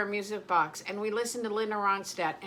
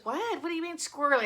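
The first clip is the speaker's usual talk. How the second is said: higher-pitched mock bafflement; puzzled retort; 6 semitones higher, faster than usual. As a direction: imitate a baffled listener asking back. Retelling husband's puzzled question.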